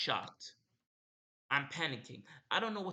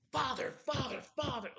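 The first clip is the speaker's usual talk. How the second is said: screaming father; 11 semitones higher than usual. Retold or imitated. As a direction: scream the name at him in rage. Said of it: "I was just screaming to myself".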